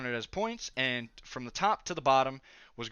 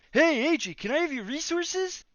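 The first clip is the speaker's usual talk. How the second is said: much higher, louder put-on girly voice; girly mimicry; 14 semitones higher, 4 dB louder than usual. Classmates crowding AJ for resources, high mocking girly voice. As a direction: ham up the begging classmates. More people come asking.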